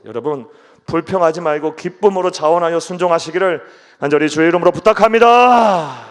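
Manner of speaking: rising to a loud earnest 부탁합니다; fervent loud plea. Real, not acted. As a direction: plead loudly in the Lord's name. Preacher's earnest climactic appeal. (간절하게 크게 부탁하며)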